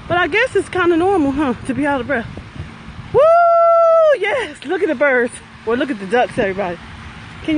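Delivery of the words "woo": long, high, loud "woo"; whoop. Real, not acted. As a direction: let out a sustained high whoop. Whoops while power walking.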